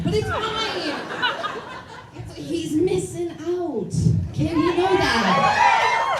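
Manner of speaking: high, slow laughing brave face; mock-cheerful laughing denial; forced laughing. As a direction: laugh it off, not fine at all. Forced cheer after cheating reveal.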